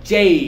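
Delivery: "জেই" with firm j and a glide up to ee; correct J. Shown as a demonstration. Teacher giving learners the right letter name, correct "জেই" with glide. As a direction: model "জেই", closing the vowel clearly into y. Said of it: "সঠিকটা কি হবে?".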